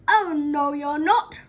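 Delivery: big storybook roar of refusal; roaring troll. A narrator's reading. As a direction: bellow it as an outraged troll. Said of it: "roared the troll".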